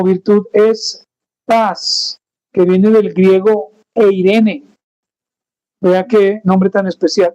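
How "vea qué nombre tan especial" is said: normal talking voice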